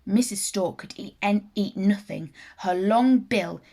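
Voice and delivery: usual speaking voice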